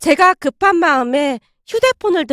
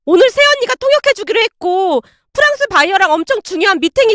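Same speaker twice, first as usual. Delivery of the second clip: furious shouting; 6 semitones higher than usual. Acted. Daughter screaming at mother over the job, furious. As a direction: scream at her in despair.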